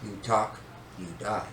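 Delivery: low-key enforcer warning, softly spaced out; quiet threat. Retold or imitated. Older brother silencing younger one, quiet threat. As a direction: imitate a menacing look in words, hushed. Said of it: "I gave him one of those".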